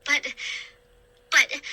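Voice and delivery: sputtering. A narrator's reading. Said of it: "I sputtered".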